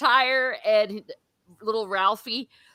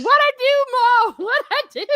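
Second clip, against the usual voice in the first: put-on much higher, louder childish protest; mock yelling kid; 6 dB louder than usual. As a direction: yell it like a kid being punished. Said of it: "he's yelling".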